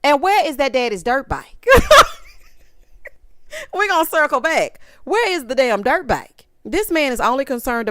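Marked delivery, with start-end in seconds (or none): 1.7-2.2 loud, high cackle; loud laugh. Real, not acted. Recapper cracking up over the dirt bike, loud laugh.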